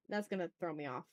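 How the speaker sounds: normal talking voice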